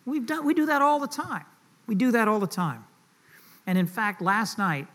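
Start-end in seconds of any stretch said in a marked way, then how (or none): none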